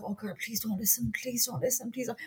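softly begging, a half-joking plea; hushed pleading. Retold or imitated. To the mother in front of Joan, hushed mock pleading. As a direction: plead under your breath for her to stop. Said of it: "I was like".

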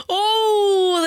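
gasp, then high, drawn-out wail; mock shock. Co-host reacting to a catty remark, theatrically scandalized. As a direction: savour the insult with theatrical horror.

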